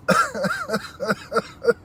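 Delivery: very high squealing laugh trailing off; wheezing, teary laugh; the laughter dying down at last; tearful laugh. Real, not acted. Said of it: "I'm in tears now".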